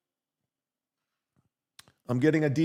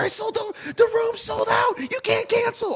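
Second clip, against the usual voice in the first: much higher squeaky mock-woman voice; mocking booker. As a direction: mimic the panicked booker shrilly. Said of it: "she's like".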